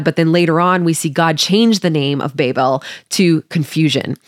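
normal talking voice

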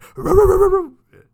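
sharp high yelp like a barking hen. Retold or imitated. Imitating the barking hen noise in a loud high yelp. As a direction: let out a loud yip.

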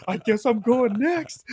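high, slow dawning realisation; acted-out stunned disbelief; startled realisation. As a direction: let it rise as it sinks in. Acts out realising he is next.

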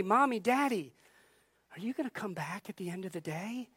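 softly voiced child asking parents; a hint of a small child, hushed and unsure; anxious child. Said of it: "like the parents dropping off the child".

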